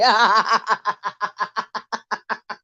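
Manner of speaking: long breathy laughing fit; cackling laughter at the monkeypox line; real laughter. Real, not acted. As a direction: laugh hard at your own joke. Laughing at own joke.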